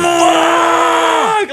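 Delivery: long, high re-enacted scream; loud yell. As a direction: act out a drawn-out, high yell of frustration. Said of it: "let out such a la-, loud yell".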